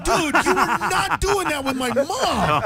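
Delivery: high, loud, slow, laughing protest; object, appalled but amused; laughing disbelief. Retold or imitated. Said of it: "I'm like".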